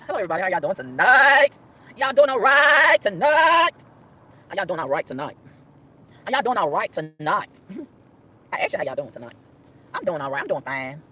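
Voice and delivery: drawn-out preacher's hello to the congregation; mock pastor greeting. Pretend pastor greeting an empty car, drawn out. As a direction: greet the flock slowly.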